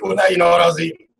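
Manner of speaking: ordinary talk, nothing marked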